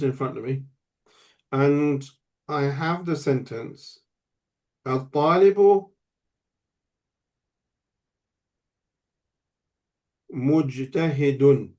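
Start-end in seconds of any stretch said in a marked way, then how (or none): 4.9-11.7 word by word, with a long pause between. Shown as a demonstration. Writing out the example sentence.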